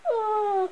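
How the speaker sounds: high gurgled cry; acted high gurgle; gurgling scream. A narrator's reading.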